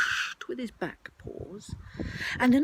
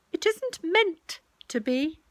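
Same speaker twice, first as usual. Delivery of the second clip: high, slow put-on voice; disguised voice; 9 semitones higher, 3 dB louder, slower than usual. Rabbit hiding who he is, disguised voice. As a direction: keep up the high disguise, slowly. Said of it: "in a different sort of voice".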